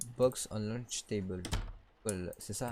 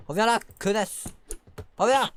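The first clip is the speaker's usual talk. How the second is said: loud, higher shout after her; yelling; 9 semitones higher, 10 dB louder than usual. Real, not acted. Shouting at the runaway baby to come back.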